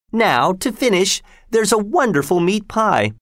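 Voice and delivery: high, proud hostess voice; proud sister. Acted. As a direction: announce the pie proudly. Sister announces the pie.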